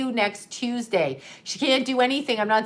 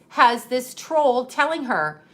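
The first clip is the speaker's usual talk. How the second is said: usual speaking voice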